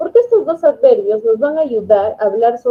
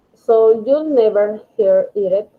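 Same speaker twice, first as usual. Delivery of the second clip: slow, louder reading of "never"; slow model reading; 4 dB louder, much slower than usual. Shown as a demonstration. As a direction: read it slowly, each word clear. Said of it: "entonces es never". (lectura lenta y marcada del ejemplo)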